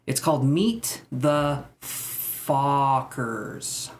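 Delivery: Meet the Fockers, slow, every sound of Fockers spelled out; slow and careful, lean on Fockers; stress on Fockers. Shown as a demonstration. Streamer carefully says the title so it won't sound like swearing. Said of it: "I'm gonna emphasize the word".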